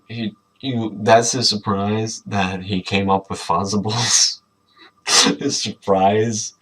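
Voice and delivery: ordinary talk, nothing marked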